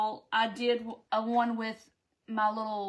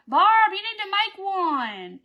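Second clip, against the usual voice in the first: louder, higher, slowed urging to a viewer; excited call-out; 9 semitones higher, 9 dB louder, slower than usual. Real, not acted. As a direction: cheer Barb on to try it. Calling out to a viewer.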